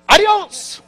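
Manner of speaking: "adios?" sung out high and loud in mock Spanish; hammed-up Spanish. Shown as a demonstration. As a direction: belt "adios?" like a showy Spaniard. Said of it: "How do you s".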